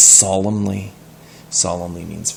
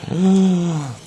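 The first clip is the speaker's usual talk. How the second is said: sharp gasp; 10 semitones higher than usual. Acted. Shocked gasp in story.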